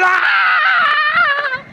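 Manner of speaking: very high, loud crying scream; child's wail. Retold or imitated. Hurt daughter answering her father, imitated screaming wail.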